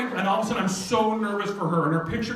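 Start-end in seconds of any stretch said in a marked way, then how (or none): none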